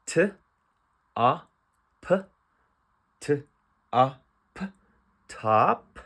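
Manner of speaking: each sound of "top" alone, then blended; phonics sounding out. Shown as a demonstration. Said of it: "I'm going to use the phonics".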